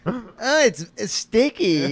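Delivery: slow mock whine about stickiness; whiny voice. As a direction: whine it like a picky kid. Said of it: "just whiny".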